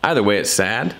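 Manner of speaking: normal talking voice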